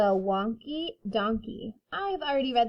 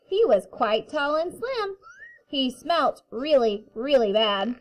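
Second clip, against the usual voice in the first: put-on reading to children; 4 semitones higher, 6 dB louder than usual. A narrator's reading. Reading picture book to kindergarten.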